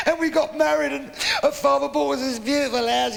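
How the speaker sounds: high-pitched, sustained mock weeping; crying old man. Old man pouring out his love story, comically tearful. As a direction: tell it all through hammed-up tears. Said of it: "crying his eyes out".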